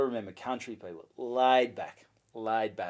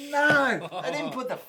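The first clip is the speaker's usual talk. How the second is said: exasperated retort; 8 semitones higher, 5 dB louder than usual. Retold or imitated. Retold exasperated reply at reception.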